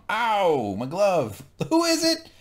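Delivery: high, loud mock cry then slow reveal; hammed-up shout. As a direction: ham up the pain.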